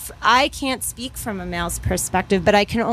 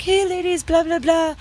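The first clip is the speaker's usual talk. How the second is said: catcalling guys; 8 semitones higher than usual. Retold or imitated. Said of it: "a bunch of guys were like".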